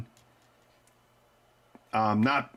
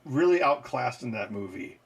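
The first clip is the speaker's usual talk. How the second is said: usual speaking voice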